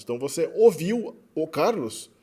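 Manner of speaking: ordinary delivery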